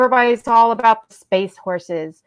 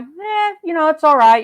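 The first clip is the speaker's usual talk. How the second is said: a mock indifferent voice, pitched much higher; unimpressed shrug; 6 semitones higher than usual. Stranger outside the theater shrugging off episode nine, in mock higher indifference. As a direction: shrug it off like it was just okay. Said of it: "They're like".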